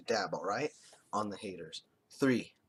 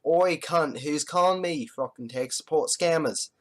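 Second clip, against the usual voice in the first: loud, rough Australian bloke answering the phone; Australian accent; 5 semitones higher, 6 dB louder than usual. Retold or imitated. Answering a suspected scammer in a loud Australian accent. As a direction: bark it in a thick Australian accent. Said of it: "I answered the phone exactly like this".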